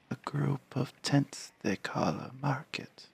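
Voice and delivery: put-on high, slow, hushed old Varl; creaky elder giant, slow and soft. Voices old Varl character.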